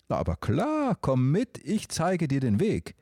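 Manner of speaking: high, bright bird voice lifted well up; small talking dove greeting the emperor; chirpy dove. Acted. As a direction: chirp it like a cheerful little dove. Dove Till offers help.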